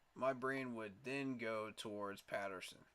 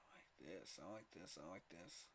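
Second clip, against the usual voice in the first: murmured approval; 5 semitones lower, 13 dB quieter than usual. Real, not acted. Repeating approval under breath.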